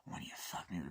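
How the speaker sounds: quiet menacing whisper; threatening whisper. Retold or imitated. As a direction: breathe the threat. Character whispering.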